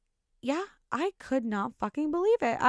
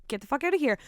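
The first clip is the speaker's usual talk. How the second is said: normal talking voice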